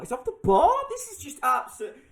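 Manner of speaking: can't believe it hit the bar; disbelief. Real, not acted. Disbelief at the same event.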